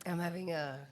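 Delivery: raspy voice. Real, not acted. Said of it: "I'm having a raspy voice day today".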